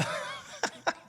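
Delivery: airy silent laugh; real helpless laugh; breathy laugh. Real, not acted. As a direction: laugh almost without voice. Laughing at the chat.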